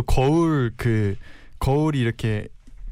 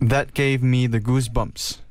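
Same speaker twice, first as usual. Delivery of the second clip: slow careful repeat; much slower than usual. Shown as a demonstration. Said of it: "이라고 하면 될 것 같아요".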